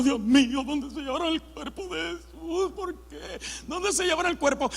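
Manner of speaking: high, hushed mock weeping; tearful. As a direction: act out a grieving woman's soft, high lament. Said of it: "María está llorando". (llanto actuado, agudo y quedo)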